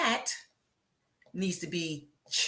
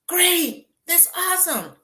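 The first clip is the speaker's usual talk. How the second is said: bright cheerful reply; 11 semitones higher, 3 dB louder than usual. Retold or imitated. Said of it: "And I go".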